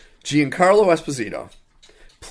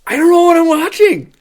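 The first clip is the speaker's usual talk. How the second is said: incredulous high exclaim; 13 semitones higher, 7 dB louder than usual. Retold or imitated. Telling a friend about a bad movie, shocked high outburst. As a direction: voice jumps high and loud in disbelief. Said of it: "And I was like".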